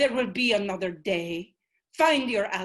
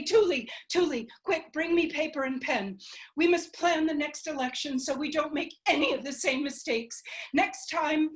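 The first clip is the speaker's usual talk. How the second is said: higher, hurried excited voice; eager planning; 6 semitones higher than usual. Acted. Selena calling Tuli to plan the next election, excited. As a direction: rush to start the next fight.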